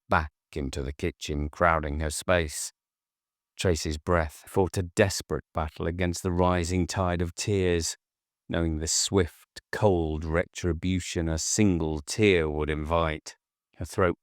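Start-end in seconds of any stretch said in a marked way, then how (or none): none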